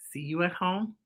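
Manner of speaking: plain reading voice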